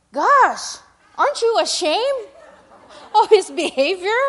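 high, loud disapproving gasp of a question; imitating a scandalised parent; judgmental.

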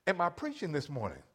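normal talking voice

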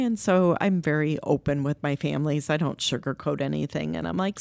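usual speaking voice

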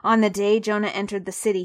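ordinary delivery, nothing marked

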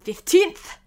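lightly voiced March Hare, high and loud; March Hare interjecting. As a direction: pipe up with a high, loud correction.

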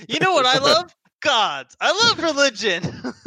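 bright, eager preacher cheer, laughing; upbeat youth pastor. Acted. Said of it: "Youth pastor voice".

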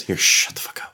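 whispered. Real, not acted. Whispered aside.

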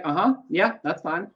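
mild gruff brush-off, softly spoken; quiet, offhand acknowledgement, imitating a gruff man; gruff Bernie Sanders. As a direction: play a lightly gruff, unbothered reply. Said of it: "he's sort of gruff".

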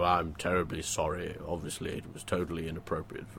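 a hint of a smarmy minister saying sorry; mock politician apology. Host mocking a politician's public apology, hushed and smarmy. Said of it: "when they come out and they go".

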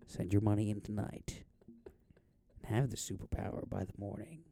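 hushed infomercial whisper, quick at the end; whispered ad tagline. Pretend. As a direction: breathe the sales pitch out in a whisper.

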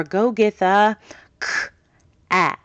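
cat split into k and at with pauses; segmented sounds. Shown as a demonstration. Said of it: "individual sounds like".

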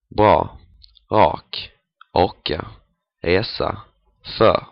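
bra, rak, orka, resa, för with R as small as possible; barely-there R in bra, rak, orka, resa, för; minimal R. Shown as a demonstration. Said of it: "making the R sound as small".

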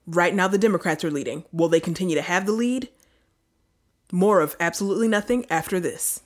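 dramatic breaking-news anchor; news anchor impression. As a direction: tease it like cable news. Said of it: "our impression of all of the news".

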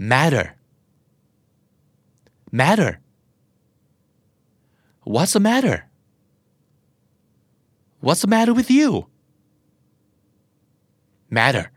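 "matter" said like "madder"; American flap T. Shown as a demonstration. Teacher drilling learners on matter, flap T. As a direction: tap the T in "matter" as a D. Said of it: "T ที่อยู่กลางคำแล้วกลายเป็นเสียงตัว D".

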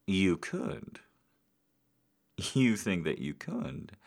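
teasing. Acted. Teasing rise.

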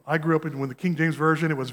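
usual speaking voice

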